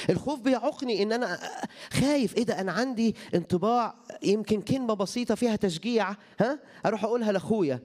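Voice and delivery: high, driving sermon voice; impassioned preaching. Acted. Preacher to the congregation, high and insistent. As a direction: press the point about fear hard.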